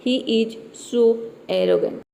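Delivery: patient teacher's reading; slow model sentence. Shown as a demonstration. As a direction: slowly spell the sentence out for the class. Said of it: "तो हम बोलते हैं ऐसे में कि".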